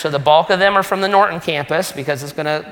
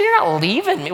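higher, put-on indignant voice, falling hard on You're; mock indignant; 5 semitones higher than usual. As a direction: scold him in a joking huff, You're leading. Pastor jokingly refuses friend's leaving.

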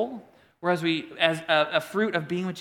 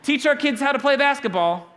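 higher and louder, bossy parents' voice; mock demanding parents; 6 semitones higher, 6 dB louder than usual. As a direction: demand it like pushy parents at a party. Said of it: "they're all like".

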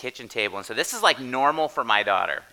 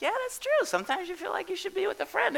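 very high, soft sing-song agreeing voice; mock agreement; 4 dB quieter than usual. Family playfully agreeing with the child's page, squeaky and high. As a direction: play along in a squeaky make-believe voice. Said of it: "We're like".

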